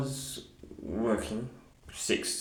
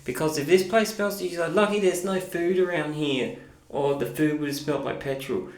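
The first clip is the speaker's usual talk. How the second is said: higher, heated rant; mock furious rant; 7 semitones higher than usual. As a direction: blast him to protect her job. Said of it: "she went off and she goes".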